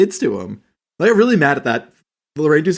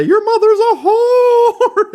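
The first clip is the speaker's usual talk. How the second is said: much higher and louder, slow, laughing shriek; Paul Bearer impression; 8 dB louder, much slower than usual. Recalling a wrestling manager's line to cohosts, laughing shrill wail. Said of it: "Didn't Paul Bearer say".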